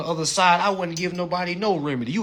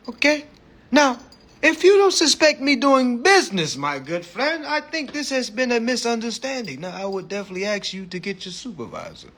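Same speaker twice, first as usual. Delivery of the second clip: high mock-British formality, easing down; put-on British accent; 7 semitones higher than usual. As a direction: lecture an officer in a hammed-up London accent. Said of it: "talking like a British man".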